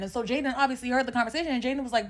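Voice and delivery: normal talking voice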